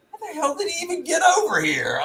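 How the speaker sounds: high, shouted outrage; pissed off. Retold or imitated. Angry park owner shouting at the stray worker, high. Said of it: "I was so pissed off that I was like".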